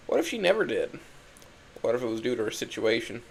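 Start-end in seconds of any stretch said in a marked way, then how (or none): 0.1-1.0 much higher, distressed question; pitched-up, pained disbelief; anguished doubt. Acted. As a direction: let the doubt rise into a high cry. Dying narrator suddenly doubts her love.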